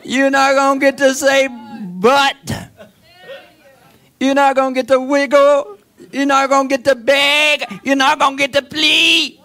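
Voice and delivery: high, loud preaching, shouting Run; preaching at full force. Acted. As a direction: hammer each line at the congregation. Preacher hammers the congregation.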